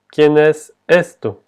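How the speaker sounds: slow, clear ¿quién es esto?; slow model phrase. Shown as a demonstration. Teacher giving the Spanish translation, slowly. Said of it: "se puede traducir como".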